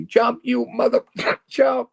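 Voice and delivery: high-pitched imitated heckler yell; mock yell. Campers heckling a man on the bridge, mock yelling. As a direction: yell it up like a jeering crowd. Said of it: "they're yelling at me".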